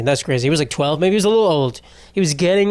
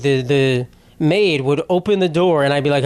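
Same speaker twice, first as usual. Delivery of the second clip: usual speaking voice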